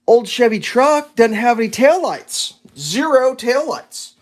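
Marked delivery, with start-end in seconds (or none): 2.8-4.1 Zero drawn out slowly and hit hard; stress on zero. Real, not acted. Stressing missing taillights.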